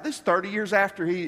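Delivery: normal talking voice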